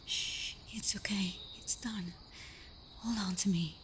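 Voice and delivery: tender hush; calm them with a breath; gentle voiceless comfort; soothing whisper. Acted. Comforting after pulling arrow.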